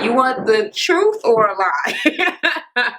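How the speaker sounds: slow, high-pitched coy question; mock-delicate stalling before bad news; teasing retold reply. Retold coy answer about looks.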